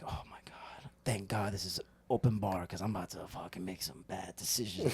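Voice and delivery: low, near-whispered thought to himself; hushed awe. Retold or imitated. Man recalls ogling jacked coworkers at a party, hushed awe. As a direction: drop to a soft, smitten murmur.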